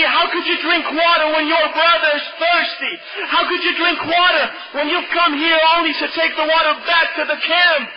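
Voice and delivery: high-pitched, impassioned preacher's cry; impassioned self-rebuke. Retold or imitated. Flag bearer asking himself how he could drink, impassioned rebuke.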